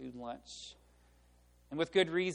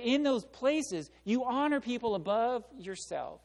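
raised, louder preaching build; preaching at full force; 7 semitones higher, 6 dB louder than usual. Acted. Preacher urging the church to honor others, emphatic and loud. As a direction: drive the point home to the congregation.

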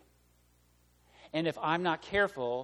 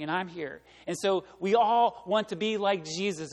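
loud, impassioned pulpit preaching that climbs higher; preaching at full force; 4 semitones higher, 8 dB louder than usual. Acted. Preacher tells the congregation about Jesus at the cross, impassioned and loud. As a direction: drive it home like a revival preacher.

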